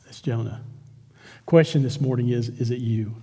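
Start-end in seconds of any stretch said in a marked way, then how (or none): 0.0-0.6 a hushed, breathy whisper. Shown as a demonstration. Repeated line, then whispered. 2.5-3.1 soft, low, searching; hushed, personal challenge to the listeners; quiet probing question. Real, not acted. Pointed question to congregation.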